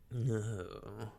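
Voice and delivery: knowing, drawn-out denial; insinuating. A narrator's reading. Mister Elton pressing his suit on Emma, insinuating. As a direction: say it with a sly implication. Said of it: "in an accent meaning to be insinuating".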